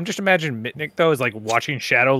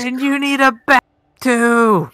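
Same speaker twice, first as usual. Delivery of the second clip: teasing call; 10 semitones higher, 5 dB louder than usual. Real, not acted. Teasing Orin.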